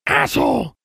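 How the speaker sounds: high squawk; a bird parroting a swear word; high, shrill-free bird squawk; squawking raven. A narrator's reading.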